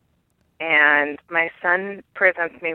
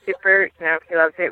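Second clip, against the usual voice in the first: normal talking voice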